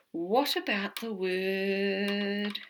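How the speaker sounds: loud, slow drawn-out question; slow teasing question. Shown as a demonstration. Teacher teasing the next word, drawn out. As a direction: stretch the question to build suspense. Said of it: "teacher building suspense".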